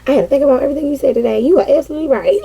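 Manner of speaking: fast, eager confession to the boss; rushed text to boss. Retold or imitated. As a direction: gush it out quickly. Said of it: "I'm like".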